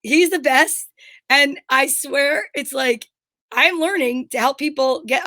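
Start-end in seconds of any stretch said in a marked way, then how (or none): none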